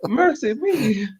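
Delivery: high, drawn-out comic wail; mock distress. Friend teasing the driver about his nerves, mock distress. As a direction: stretch it out high in hammed-up dismay.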